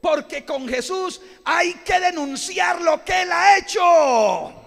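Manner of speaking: rally the congregation to testify; preaching at full force. Real, not acted. Preacher urging the church to declare miracles, shouting.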